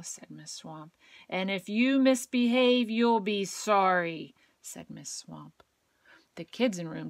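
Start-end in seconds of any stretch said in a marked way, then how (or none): 1.3-4.3 loud, slowed threat; stern threat. A narrator's reading. Miss Swamp threatening the class, loud. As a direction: warn them menacingly.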